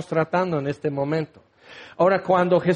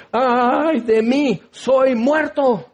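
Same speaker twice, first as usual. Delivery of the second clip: slow, drawn-out cry of dread, pitched higher; ay de mí, dragged out and raised in fear; Isaiah's dread; 5 semitones higher, much slower than usual. Acted. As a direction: stretch each word like a terrified prophet. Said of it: "y Isaías dice".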